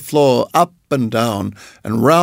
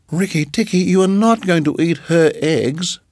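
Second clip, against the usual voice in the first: higher, excited chirpy bird; little bird voice; 5 semitones higher than usual. Acted. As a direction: chatter proudly like a small bird. Darzee voice.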